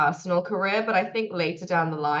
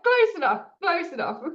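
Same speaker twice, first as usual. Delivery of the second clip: laughing close enough; 12 semitones higher than usual. Retold or imitated. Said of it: "it's like".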